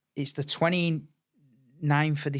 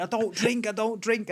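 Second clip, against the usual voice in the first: mock protest, pitched well up; high-pitched protest; 10 semitones higher than usual. Player retelling refusing teammates' drink, high protest. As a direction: plead it high, twice over.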